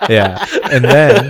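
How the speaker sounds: loud laughing through the words; embarrassed laughing. Real, not acted. Admitting his worst drunk night, loud laughing.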